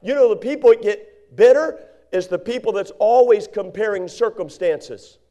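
usual speaking voice

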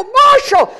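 high, alarmed call of the name; panicked call. Retold or imitated. Preacher finding the injured boy, high and alarmed. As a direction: call his name in sudden fear.

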